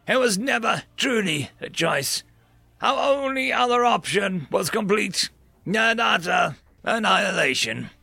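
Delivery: solemn, rising dramatic address; grave alien speaker. Acted. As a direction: speak like a defeated alien general. Narrating an alien's grave speech.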